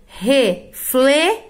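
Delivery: each word stretched slowly with pauses; slow example words. Shown as a demonstration. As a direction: model the words slowly for pupils. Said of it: "como o caso de claro". (exemplos ditos bem devagar)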